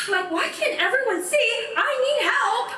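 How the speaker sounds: high, tearful begging; desperate plea. Acted. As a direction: plead as if close to tears, voice climbing high. Relives breakdown, begging for help.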